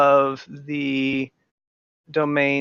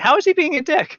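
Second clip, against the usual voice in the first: much higher-pitched disbelieving question; incredulous challenge; 13 semitones higher than usual. Pretend. Game master challenges players calling Pascal a dick, incredulous.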